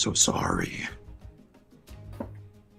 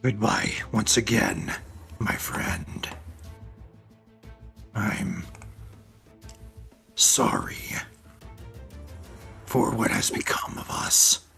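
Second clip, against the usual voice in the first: sad whisper. Acted. Grom's farewell to caged friend.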